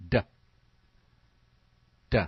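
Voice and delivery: short voiced d, no vowel after; just the d sound, crisp; d sound alone; isolated d sound. Shown as a demonstration. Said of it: "D makes this sound".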